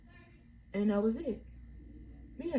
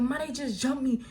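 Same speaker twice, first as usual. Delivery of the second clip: imitating an upset rant to Mom, pitched up; retold going off; 4 semitones higher than usual. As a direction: replay the outburst in a higher, contained voice. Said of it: "I'm just going off like".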